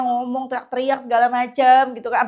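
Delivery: usual speaking voice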